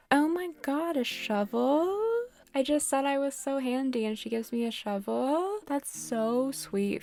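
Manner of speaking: sarcastic wonder at a shovel; ironic delight. Real, not acted. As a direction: act touched by the gift. Ironic reaction to shovel gift.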